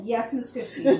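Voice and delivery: laughing mock-dutiful yes; chuckling yes ma'am; laughing reply. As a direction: answer her with amused obedience. Obliging Christine, laughing.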